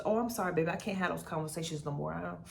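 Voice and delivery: ordinary talk, nothing marked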